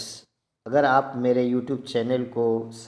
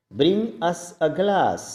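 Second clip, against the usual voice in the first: slow model sentence; 4 semitones higher, much slower than usual. Shown as a demonstration. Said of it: "model sentence said twice".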